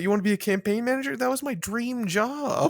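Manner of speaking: poor guy. Retold or imitated. Voicing the hapless Walmart worker.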